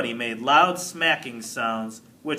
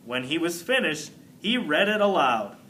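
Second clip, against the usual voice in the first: plain reading voice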